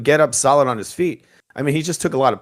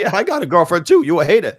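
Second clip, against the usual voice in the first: mocking boxer voice; 6 semitones higher, 5 dB louder than usual. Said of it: "Ellie Setback would say".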